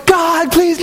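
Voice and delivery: high exasperated sideline cry; a soccer parent groaning at the field; mock parent yell. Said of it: "they're just yelling God's name".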